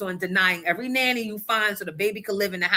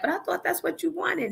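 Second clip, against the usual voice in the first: high, softer therapist voice; mock puzzled therapist; 9 semitones higher, 4 dB quieter than usual. Therapist asking the husband, voiced high and soft. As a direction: play the therapist's innocent question. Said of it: "the therapist was like".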